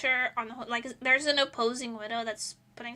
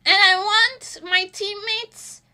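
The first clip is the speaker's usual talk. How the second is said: higher, louder exasperated whine; frustrated rant; 4 semitones higher, 6 dB louder than usual. Real, not acted. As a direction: blow off steam, pleading loud. Venting frustration about teammates.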